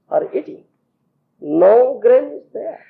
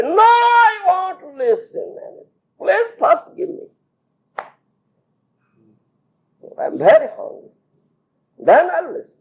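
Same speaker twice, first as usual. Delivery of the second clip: insisting; 8 semitones higher than usual. Retold or imitated. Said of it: "Krishna insisting on food".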